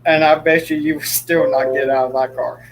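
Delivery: ordinary delivery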